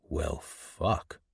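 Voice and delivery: low, hushed curse under the breath; whispered. A narrator's reading. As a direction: breathe it out, barely voiced. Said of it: "Ryun whispered".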